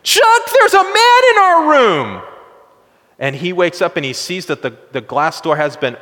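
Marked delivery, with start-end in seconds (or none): 0.0-2.2 high, loud, panicked scream; wife screaming in panic at a man in the room. Retold or imitated. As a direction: cry out in terror at an intruder. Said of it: "his wife wakes up screaming".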